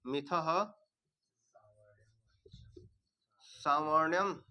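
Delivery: मिथ then सावर्ण्यम drawn out slowly; slow teaching read; slow recitation. Shown as a demonstration. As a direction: recite word by word for the class. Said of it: "मिथ सावर्णम".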